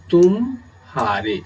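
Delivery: "तुम हारे" split, a clear break after तुम; pause inside word. Shown as a demonstration. Teacher showing how a pause splits the word, pause between तुम and हारे. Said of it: "अगर हम इसमें विराम या अवसान की स्थिति".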